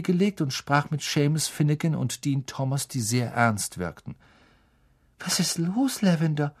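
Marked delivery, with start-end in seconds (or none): 5.2-6.5 higher, concerned question; worried. Acted. Hermine asking crying Lavender what happened, worried. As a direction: ask with a touch of unease. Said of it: "fragte Hermine beunruhigt".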